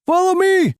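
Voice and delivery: high, urgent command; pressing high-pitched shout; calling out. Acted. Leader calls during the escape.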